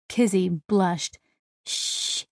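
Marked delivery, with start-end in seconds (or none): 1.6-2.2 soft voiceless shush; a quick quiet hiss to hush. A narrator's reading. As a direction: shush them softly. Blushing Kizzy hushes Bear.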